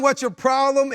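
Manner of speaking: loud, high challenge; mock confrontation. Mock confrontational line he didn't say.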